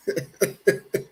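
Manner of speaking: short burst of real laughter; a quick happy laugh. Real, not acted. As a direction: laugh freely, amused. Laughing while chilling with Sekou.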